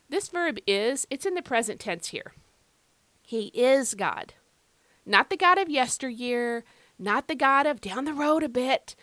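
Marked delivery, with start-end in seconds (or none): 3.2-4.3 slow, with "is" held long; "is" drawn out firmly, then "God"; emphasis on IS. Shown as a demonstration. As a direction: stretch and punch the word "is". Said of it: "why am I emphasizing this present tense verb".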